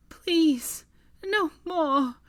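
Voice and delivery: high, loud desperate plea; pleading. Acted. Hermione begs the powers, high desperate plea. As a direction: beg the powers above. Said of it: "she pleaded".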